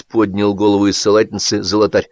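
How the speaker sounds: ordinary delivery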